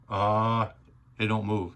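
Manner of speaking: ordinary talk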